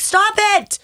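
high, loud mock protest; exasperated outburst. Acts out exasperation at group texts.

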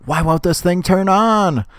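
fed-up cranky neighbor; annoyed Squidward. Retold or imitated. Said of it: "He's like".

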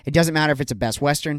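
ordinary delivery

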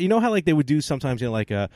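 normal talking voice

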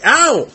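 sharp high ow; pained yelp. Acted. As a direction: yelp as if just hit. I do not like getting beat.

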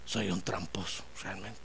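low, softened mock prayer; acted admission, dropping low and soft; humble confession. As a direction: confess it quietly to God. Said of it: "mejor decirle".

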